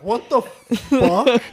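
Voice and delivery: high, slow, stunned "what"; shocked disbelief. Retold or imitated. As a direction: drag it out in shock. Shocked reaction at the news.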